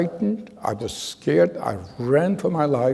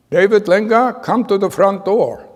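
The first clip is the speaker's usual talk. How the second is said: loud, higher call over a bullhorn; bullhorn announcement; 6 semitones higher, 6 dB louder than usual. Retold or imitated. Loudspeaker calling the boy's name to the front door, loud announcement. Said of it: "a bullhorn comes on loud and clear".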